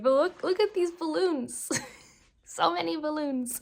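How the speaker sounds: high, gushing over balloons; excited. Real, not acted. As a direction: squeal with delight. Excited about birthday balloons.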